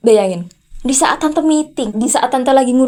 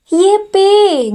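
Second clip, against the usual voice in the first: mock cheer; 6 semitones higher than usual.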